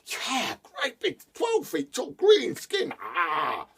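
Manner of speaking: high, eager insisting; insistent. A narrator's reading. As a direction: convince father it was real. Son insists on the demon.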